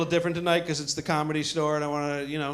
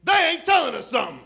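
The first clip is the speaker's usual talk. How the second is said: suspicious lady; 5 semitones higher than usual. Retold or imitated. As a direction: shout it up high like a suspicious passenger. Lady six rows behind suspicious.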